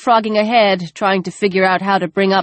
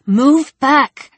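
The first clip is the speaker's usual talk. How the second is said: urgent; 7 semitones higher, 4 dB louder than usual. A narrator's reading. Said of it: "injecting as much urgency as she could".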